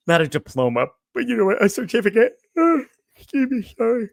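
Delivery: talking through a yawn; words stretched by a yawn. Real, not acted. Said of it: "I'm sorry for my yawning".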